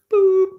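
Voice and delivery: high, loud "boop"; short electronic beep done by voice; imitated buzzer beep.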